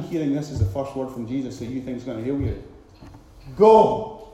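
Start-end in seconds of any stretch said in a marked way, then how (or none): 3.6-4.3 short, loud firm command. Acted. Preacher voicing Jesus dismissing the lepers, loud firm command. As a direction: send them off with one word. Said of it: "he said".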